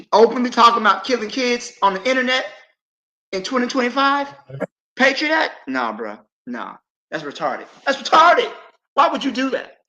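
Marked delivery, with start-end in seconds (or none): 0.1-5.7 incredulous, louder and higher; ongelovig. Real, not acted. Incredulous rant about kill talk. 7.8-9.8 shouted, much higher and louder; exasperated shout. Real, not acted. Repeat shouted high.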